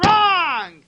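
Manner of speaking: high, loud call of wrong; emphatic correction. Real, not acted. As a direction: cut them off, loud and playful. Teacher corrects children loudly.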